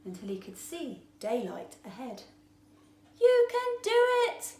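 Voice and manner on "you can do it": high, loud cheer from far below; yelled. A narrator's reading. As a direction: shout up to encourage him. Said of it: "yelled Tiny from below".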